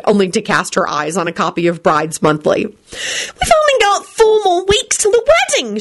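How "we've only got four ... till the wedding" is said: high, slow, tearful shriek. Acted. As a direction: shriek it in a panic. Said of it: "she shrieked".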